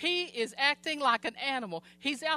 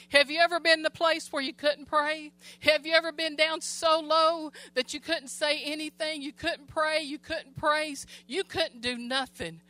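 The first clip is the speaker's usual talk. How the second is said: fervent preaching; 4 semitones higher than usual. Acted. Preacher rousing the church with questions, fervent preaching.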